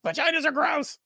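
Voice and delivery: very high, hammed-up shriek; piercing imitation of a squeamish man; mock disgusted scream. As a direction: cry out in exaggerated revulsion. Mocking the man in the clip.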